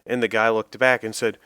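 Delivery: ordinary talk, nothing marked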